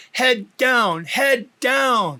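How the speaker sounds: officer yelling at the wife. Acted. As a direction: yell the command twice. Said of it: "yells loudly at her".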